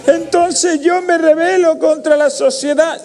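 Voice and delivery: very high, mocking victim voice; mock a hard-luck case in a squeaky drawn-out voice; self-pitying whiner. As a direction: whine it high, dragging out the end. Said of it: "no de esos hijos de puta que hay por ahí que dicen".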